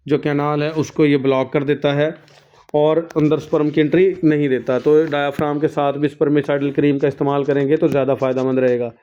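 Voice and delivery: normal talking voice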